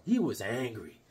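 ordinary talk

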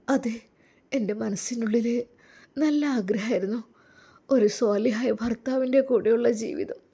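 put-on a shy young wife opening up to her husband; timid young woman. Young woman's grateful confession.

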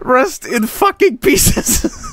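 slow, high words squeezed through laughter; laughing helplessly. Real, not acted. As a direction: drag it out while cracking up. Cracking up at the wrecked park.

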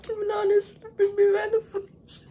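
high breathless laughter through the words; cracking up while talking; laughing. Real, not acted. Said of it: "stop laughing".

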